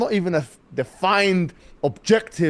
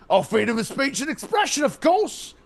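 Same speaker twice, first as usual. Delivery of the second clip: higher-pitched, rising mock reply; mock far-right supporter; 7 semitones higher than usual. Far-right man answering what British values are, mocking imitation. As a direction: parody a far-right man's pat answer. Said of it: "if you ask ten of the far right".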